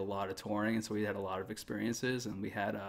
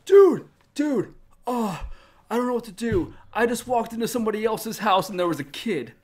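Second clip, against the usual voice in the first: high, loud panicked drunk bandmate impression; drunken freak-out; 12 semitones higher, 10 dB louder than usual. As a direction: act out a drunk friend freaking out. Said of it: "like, freaking out, shit-faced".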